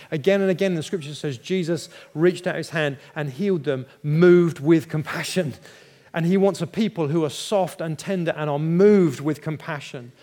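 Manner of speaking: loud, preaching with rising force. Acted. Preacher urging the congregation toward compassion, loud. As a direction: press it home like a preacher.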